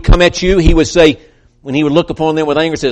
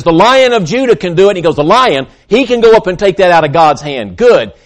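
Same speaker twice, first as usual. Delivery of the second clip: excited announcement; 5 semitones higher, 5 dB louder than usual. Acted. Elder announcing the Lion.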